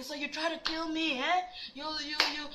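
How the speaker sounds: put-on high-pitched yapping in a Nigerian accent; attempted Nigerian accent. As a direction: imitate boyfriend nagging with Nigerian accent. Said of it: "I wanted to get the Nigerian accent in".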